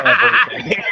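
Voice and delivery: cracking up mid-word; laughing. Real, not acted. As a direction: burst out laughing on it. Laughing punchline.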